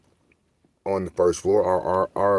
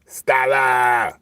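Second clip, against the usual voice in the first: slow, drawn-out holler; imitated guy's yell; 5 semitones higher, 4 dB louder, much slower than usual. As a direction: mimic a man calling up to a window. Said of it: "the voice that the guy would make".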